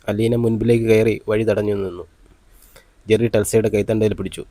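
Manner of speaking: ordinary delivery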